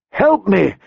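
high, urgent plea; high, pressing call for help; urgently. A narrator's reading. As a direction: snap into urgent action. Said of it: "she said urgently".

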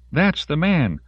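yelling. A narrator's reading. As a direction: yell the accusation high. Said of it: "Denise yelled".

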